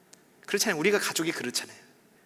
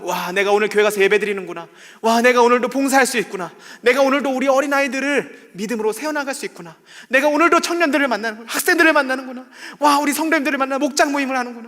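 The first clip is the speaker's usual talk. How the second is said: higher and louder, bursting with delight; joyful new believer; 8 semitones higher, 8 dB louder than usual. Retold or imitated. New believer thrilled to go to church, loud joyful gushing. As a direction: gush over every chance to serve. Believer's first joy voiced.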